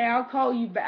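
usual speaking voice

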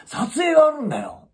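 hammed-up self-important claim; imitating a boast. Man proudly announcing his filming, mock insistent. Man's boast quoted again.

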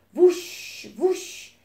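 high, rising wing whoosh; wing whooshes. A narrator's reading. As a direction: swoop upward on each wingbeat. Narrator imitates faster, stronger wingbeats.

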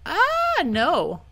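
high, loud "ah" falling into "no"; cry of dismay. Real, not acted. Player reacting to a mishap, dismayed cry. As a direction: cry out as it slips away.

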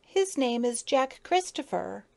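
a touch of tears; tearful plea. A narrator's reading. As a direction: plead for him by naming him. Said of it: "tears came into her eyes".

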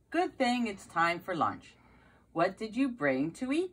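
plain reading voice

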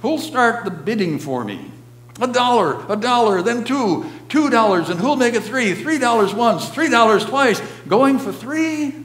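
high, loud auction chant; auctioneer. A narrator's reading. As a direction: rattle off bids to the room. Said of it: "he cried".

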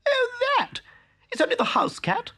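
very high, breezy and quick; careless shrug. A narrator's reading. Town mouse brushing off the cat, very high and careless. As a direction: toss it off as nothing. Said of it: "said Town Mouse with a shrug".

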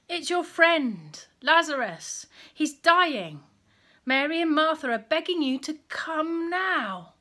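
urgent plea. Acted. Messenger pleads with Jesus.

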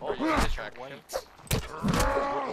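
mock war shout. Role-playing a hostile faction.

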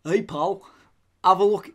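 lightly put-on northern English accent; a hint of a goofy northern accent. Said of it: "I was just doing something goofy Northern".

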